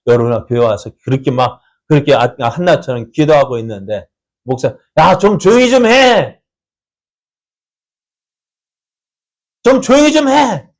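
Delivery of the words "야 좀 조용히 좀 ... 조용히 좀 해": a pastor yelling at a noisy prayer; scolding pastor. Acted. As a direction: hush the praying girl sharply. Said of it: "이렇게 한번 해볼까요".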